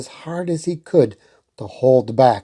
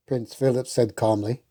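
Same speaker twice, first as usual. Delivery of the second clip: usual speaking voice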